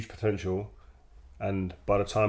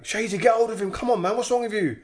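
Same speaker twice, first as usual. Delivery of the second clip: mock yell; 11 semitones higher than usual. Mocking teammate yelling at Shazy.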